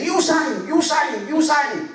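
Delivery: high, loud "You sign!"; insistent delivery man. Retold or imitated. Storyteller voicing the courier insisting, high and loud. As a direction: shove the words up high and loud. Courier repeats demand three times.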